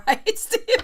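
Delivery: high, laughing question tossed to a viewer. Real, not acted. Reader laughing at Stephen's joke, asking him laughing.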